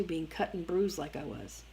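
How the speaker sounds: usual speaking voice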